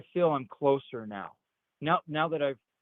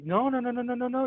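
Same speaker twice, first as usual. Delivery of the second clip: imitated alarmed group members; 10 semitones higher than usual. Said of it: "they were like".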